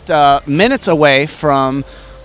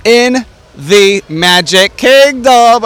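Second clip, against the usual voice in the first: lucha libre announcer; 2 semitones higher than usual. Acted. Podcast intro, announcer style.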